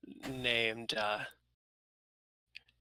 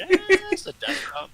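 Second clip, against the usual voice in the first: squealing, higher and louder; excited; 10 dB louder than usual. Real, not acted. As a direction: gush over the move. Excited drag reference.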